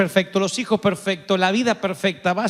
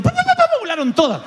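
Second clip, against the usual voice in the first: fluttering doves; faster than usual. Retold or imitated. Doves flying off, imitated.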